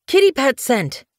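high, urgent announcement; excited calling. Acted. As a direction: call the news out, pitched right up. Apprentice runs back with news.

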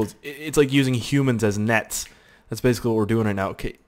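ordinary delivery

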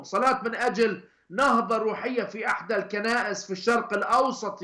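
crying out for healing, pressing each plea; fervent pleading prayer. Real, not acted. Pleading prayer grows intense. (صلاة متوسلة بحرارة وبصوت أعلى)

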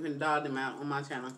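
speaking between bites; talking while chewing. Real, not acted. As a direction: mouth partly full of crab. Seen 0: puts crab pieces to mouth, then cracks legs while talking.

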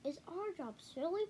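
a hint of a bossy big brother; lightly voiced young cub. A narrator's reading. Brother voiced, higher child voice.

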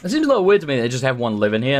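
usual speaking voice

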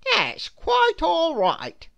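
high, slow, gentle reassurance; kind stranger. A narrator's reading. Stranger kindly forgiving Mister Good, high and slow. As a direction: let him off gently. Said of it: "said the man".

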